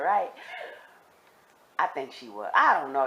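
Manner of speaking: usual speaking voice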